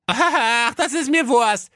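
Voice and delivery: high laughing carefree prince; high cartoon prince, cheerfully. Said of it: "sagte der Rocco ... fröhlich".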